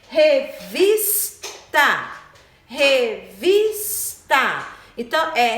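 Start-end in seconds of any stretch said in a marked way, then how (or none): 0.1-2.1 "Revista" slow, with VIS loud and stretched; syllable by syllable "Revista", strong on VIS; stressed syllable. Shown as a demonstration. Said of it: "Ela é mais forte". 2.6-4.6 "Revista" slow, with VIS loud and stretched; syllable by syllable "Revista", strong on VIS; stressed syllable. Shown as a demonstration. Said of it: "Ela é mais forte".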